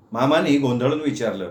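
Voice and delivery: usual speaking voice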